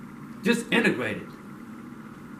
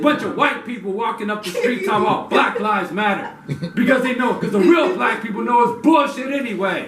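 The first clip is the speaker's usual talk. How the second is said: angry rant; 8 semitones higher, 7 dB louder than usual. Real, not acted.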